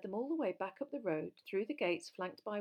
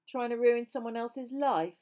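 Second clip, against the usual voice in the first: raised, forceful angry voice; loud, higher-pitched challenge; loud accusation; 5 semitones higher, 9 dB louder than usual. A narrator's reading. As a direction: call it out so others turn round.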